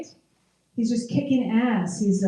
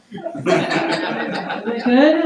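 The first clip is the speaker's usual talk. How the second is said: ordinary delivery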